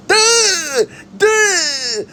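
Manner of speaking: very high, loud dopey "duh" like a cartoon simpleton; mock the fighter as a brainless goof; mock dumb voice. Vlogger mocking Charles Oliveira's logic in a dopey cartoon voice. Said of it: "how I picture him when he talks like this".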